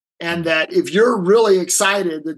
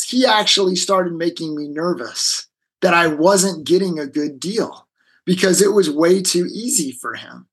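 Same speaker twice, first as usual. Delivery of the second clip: ordinary delivery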